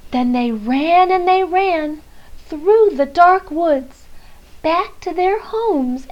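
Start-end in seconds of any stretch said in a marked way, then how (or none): none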